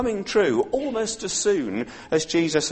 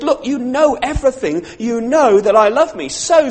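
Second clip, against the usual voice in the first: a touch of heated protest, louder; mild exasperation; 8 semitones higher, 6 dB louder than usual. Retold or imitated. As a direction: Peter hot under the collar, pushing back. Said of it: "getting quite hot under the collar".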